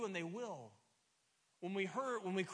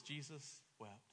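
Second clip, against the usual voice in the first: hushed; 4 semitones lower, 8 dB quieter, slower than usual. Shown as a demonstration. Quoting the verse reverently.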